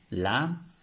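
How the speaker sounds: ordinary talk, nothing marked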